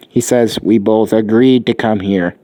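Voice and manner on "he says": plain reading voice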